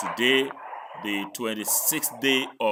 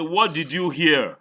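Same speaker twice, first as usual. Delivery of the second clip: raised, louder questioning; insistent demand; 4 semitones higher, 5 dB louder than usual. Real, not acted. Teacher pressing student who sidesteps the question, insistent. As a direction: demand the answer firmly.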